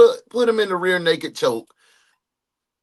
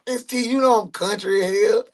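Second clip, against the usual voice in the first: usual speaking voice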